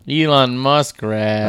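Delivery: slow, relishing each word; slow savoring. Real, not acted. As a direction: draw out the pun with delight. Savoring the pun slowly.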